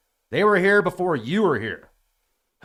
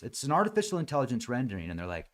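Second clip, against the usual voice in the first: normal talking voice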